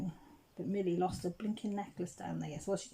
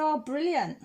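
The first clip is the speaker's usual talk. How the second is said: delighted; 8 semitones higher, 8 dB louder than usual. Retold or imitated. Relief at the find.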